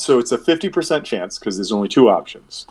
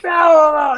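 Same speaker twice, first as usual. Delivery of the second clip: excited Irish cry; 7 dB louder than usual. Acted.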